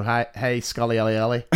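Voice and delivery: ordinary talk, nothing marked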